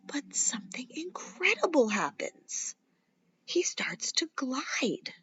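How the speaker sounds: soft, slow hushed whisper; whispered sense of wonder; awed whisper. A narrator's reading. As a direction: whisper it like a secret marvel. Wonder moment in story.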